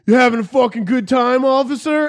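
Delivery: high, loud taunting call; mock heckling hippie. Hippie taunting a cop, put-on high shout. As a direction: holler it like a cheeky heckler.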